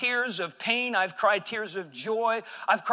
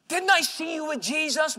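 put-on high, louder probing question from a girl; a girl pointing him out in the crowd; accusing servant girl; 9 semitones higher, 5 dB louder than usual. Servant girl confronts Peter, high accusing voice.

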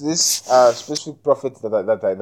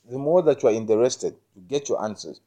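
usual speaking voice

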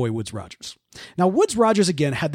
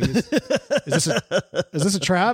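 wary pirates; 3 semitones higher than usual. Retold or imitated. Pardoned pirates suspect a trap.

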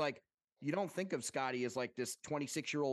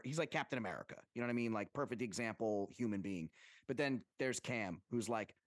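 normal talking voice

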